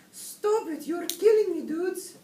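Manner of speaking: very high, loud kitten squeal; protesting kitten. A narrator's reading. Skipito begs the singing chihuahuas to stop, high loud protest.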